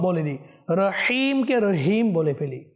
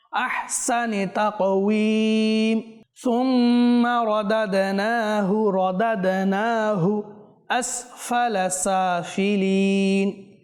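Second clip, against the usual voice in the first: slow, drawn-out melodic tajweed chant; Quran recitation; 2 semitones higher, much slower than usual. Shown as a demonstration. Teacher reciting a verse for viewers to follow, slow melodic chant. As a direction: chant each syllable long and measured.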